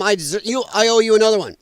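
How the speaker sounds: ordinary talk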